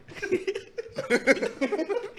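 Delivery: real squealing laughter, high-pitched; high helpless laugh. Real, not acted. Cohost cracking up retelling casino guy, squealing laugh. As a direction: laugh till your voice goes up.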